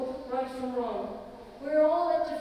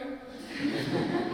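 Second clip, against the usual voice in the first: breathless laugh; 2 semitones higher than usual. Real, not acted. Reacting to priest.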